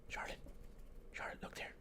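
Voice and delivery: breathy whisper to the cat; hushed whisper, coaxing the cat. Real, not acted. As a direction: whisper so only the cat hears.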